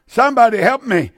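anguished shout for help; crying out for help. Acted. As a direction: plead loudly like a man in torment. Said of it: "and you cry out".

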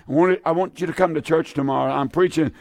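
ordinary talk

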